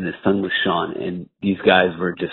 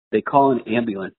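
ordinary delivery

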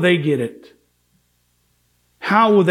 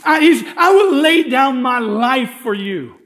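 Peter's earnest plea, much higher and slower; eager Peter; 10 semitones higher than usual. Retold or imitated. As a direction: plead like the bold disciple who insists he is ready. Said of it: "And so he says".

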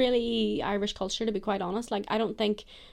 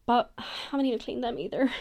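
ordinary delivery

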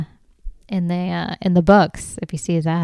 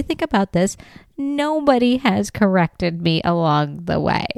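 ordinary talk

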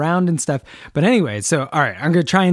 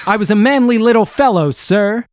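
higher and louder, proudly manly; proud patient; 5 semitones higher, 4 dB louder than usual. Retold or imitated. Host voicing George boasting to the doctor, proud and loud. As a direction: puff up and declare it.

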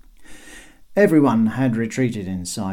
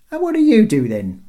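high, loud query; another questioning guard; 11 semitones higher, 8 dB louder than usual. A narrator's reading. Another guard quizzing Bob, called-down question. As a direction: lob the question down from the wall. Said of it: "asked another".

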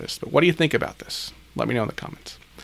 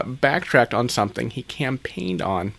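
normal talking voice

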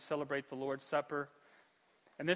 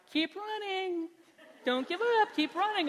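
lightly voiced chirpy sign-holders, much higher; mock cheering fans; 13 semitones higher than usual. Crowd on the sidelines urging runners on, mock cheer. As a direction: imitate perky fans calling out. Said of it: "people with their signs cheering you on".

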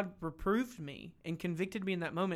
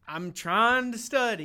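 higher, louder and slower, a put-upon protest; mock-irritated retort to a friend's favour; irritated brush-off; 6 semitones higher, 8 dB louder, slower than usual. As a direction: push him off, annoyed at the interruption. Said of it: "my first answer was like".

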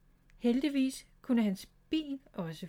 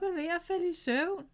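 a light giggly little-sister voice, pitched higher; giggling; 9 semitones higher than usual. Acted. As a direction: tease him with a giggle in the voice. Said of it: "fniste Miffy". (fnisende og højere)

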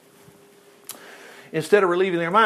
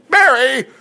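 Mary called out loud and higher; 8 semitones higher, 7 dB louder than usual. Retold or imitated. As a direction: shout her name to make her turn. Jesus calls her name.

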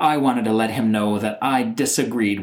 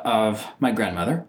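ordinary talk, nothing marked

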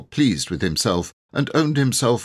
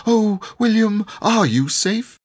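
much higher and louder anxious cry; 5 dB louder than usual. A narrator's reading. Vicar's wife cries out to her husband, high and loud. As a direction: rush in fearing for her husband. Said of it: "she cried".

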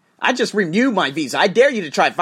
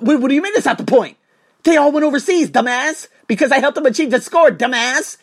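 heated argument; 9 semitones higher, 5 dB louder than usual. Retold or imitated. Retold heated quarrel with manager.

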